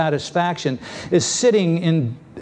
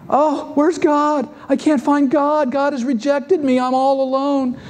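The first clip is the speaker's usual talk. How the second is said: despairing David; 8 semitones higher than usual. Acted. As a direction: cry it out as a lost sick man. David voiced, sick and forsaken.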